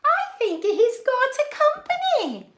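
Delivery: delighted storyteller; playful reveal. Real, not acted.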